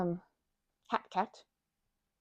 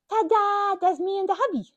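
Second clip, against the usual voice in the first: ta-da, much higher and louder; excited reveal; 10 semitones higher, 7 dB louder than usual. Real, not acted. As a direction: present it proudly. Presenting the cat plushies.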